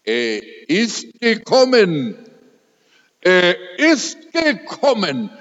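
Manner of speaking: dying wife saying he has come, radiant; radiant joy. Retold or imitated. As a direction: proclaim it beaming with joy. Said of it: "da strahlte ihr Gesicht".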